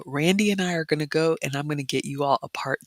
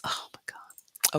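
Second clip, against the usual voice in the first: softer whispered regret; dismayed whisper; 8 dB quieter, slower than usual. Real, not acted. Vlogger regretting the croissant she missed, dismayed whisper. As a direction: whisper it like you can't believe it.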